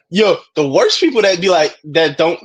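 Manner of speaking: ordinary talk, nothing marked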